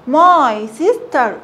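very slowly spelled out; slow demo. Shown as a demonstration. Teacher to pupils, slow drilled reading. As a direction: stretch each word for the kids.

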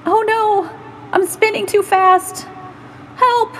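high, loud cry for help; a tiny cartoon worry losing control; panicked little worry.

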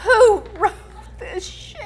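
hammed-up dramatic cry; soap opera shout. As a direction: belt it out like a soap opera actress. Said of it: "do it with different inflections each time".

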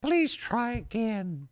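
slow high automated operator; imitating phone recording. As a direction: parrot the phone message. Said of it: "Please try your call again".

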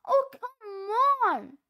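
sobbing "oh, come on" over a dead hero; crying wail. Real, not acted. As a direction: wail it out through tears. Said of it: "isn't it a little strange for you to be crying".

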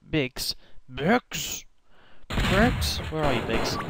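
rising call for Biggs. Pretend. Calling out for a character.

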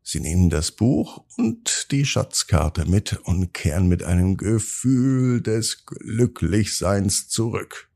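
usual speaking voice

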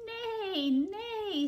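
high, drawn-out wavering "nay"; horse neigh. Acted. Reader voices the farm horse for children, high neighing. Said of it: "said the horse".

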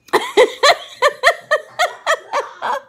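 long high cackling laugh; real uncontrollable laughing fit; cracking up. Real, not acted. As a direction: laugh loud and helplessly. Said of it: "I can't believe I'm cracking up".

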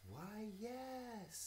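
gracious butterfly, lifted up. Acted. Butterfly character in story.

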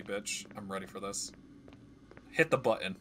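usual speaking voice

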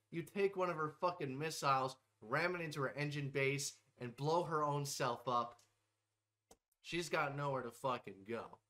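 ordinary delivery

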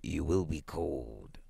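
slow, low and soft concern; tender old husband, hushed and slow. A narrator's reading. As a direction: coax her gently, drawing the words out. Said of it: "he said tenderly".

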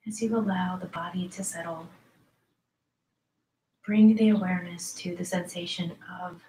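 soft, unhurried yoga nidra voice with long pauses; guided meditation. Acted. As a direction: ease listeners gently into letting the body go. Leads yoga nidra relaxation.

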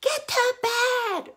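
high, loud, drawn-out parental shout; parent yelling. Acted. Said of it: "we're yelling at them".

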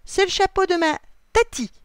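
high, loud protest; indignant shout. A narrator's reading. William defends aunt's hat from a bird, indignant shout.

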